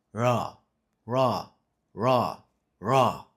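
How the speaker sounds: "ra, ra, ra, ra" pushed from the diaphragm, building in force; forced from diaphragm. Shown as a demonstration. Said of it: "you force it through your".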